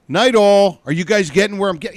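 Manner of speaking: mock announcer sign-off. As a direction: belt it out like a TV host. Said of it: "you never say it like".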